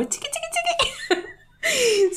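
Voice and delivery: rapid, high-pitched chiqui noises; imitated shampoo scrubbing. Host showing friend the scalp-massage sound, playful high scrubbing noise. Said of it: "del shampoo haciendo como".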